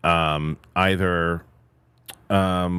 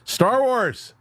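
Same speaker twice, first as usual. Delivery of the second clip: excited blurt. Real, not acted. Blurting an answer.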